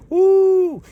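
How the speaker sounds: a high, loud whoop of delight; preacher's whoop. Real, not acted. Preacher cheering scripture on with a high loud whoop. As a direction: let out a piercing, high preacher's woo.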